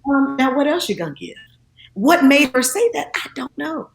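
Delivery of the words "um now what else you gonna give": amused and knowing, pressing for more; imitating Oprah. Oprah teasing the guest about her small donation, high and knowing. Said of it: "And Oprah said".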